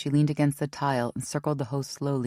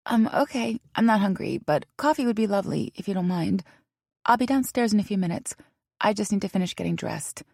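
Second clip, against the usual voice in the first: put-on self-conscious young woman; 6 semitones higher than usual. Self-conscious McKinley answers.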